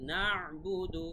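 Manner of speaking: "না'বুদু" slowly, with a throat ayn after "না"; clear ayn. Shown as a demonstration. Teacher modelling the letter for learners, clear ayn in "না'বুদু". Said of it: "আইনের উচ্চারণটা clear থাকতে হবে".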